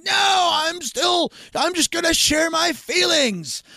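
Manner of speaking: slow, high, shouted refusal; mock stubborn shout. Addict refusing the stitches, loud slow mock shout.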